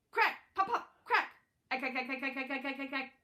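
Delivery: gunfire imitation. Narrator to listeners, imitating rattling gunfire loudly. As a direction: spit out the burst like machine-gun fire.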